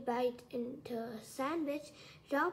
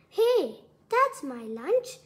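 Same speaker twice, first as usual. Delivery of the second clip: drawn-out shout, much louder; indignant yell; 4 semitones higher, 12 dB louder, slower than usual. A narrator's reading. Helen objects as Roy eats her lunch, yelling. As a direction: yell it slowly in protest. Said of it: "Helen yelled".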